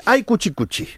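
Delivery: lightly hammed-up cuchi-cuchi coo; baby talk. Host predicting the urge to coo at a baby, light baby talk. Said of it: "un impulso irresistible de decir".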